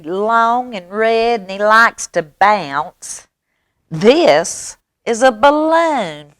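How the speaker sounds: high, slow mouse voice; know-it-all mouse. Acted. Mouse misnames another animal in story.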